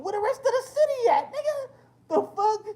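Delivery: high, loud, cracking with laughter; laughing outrage. Retold or imitated. Telling chat about the campus walk, yelling through laughter. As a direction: yell it while losing it laughing.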